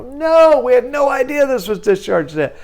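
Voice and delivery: collector's lawyer protesting innocence; indignant denial. Retold or imitated. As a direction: squeal out a mock innocent no. Collector lawyer denies knowing.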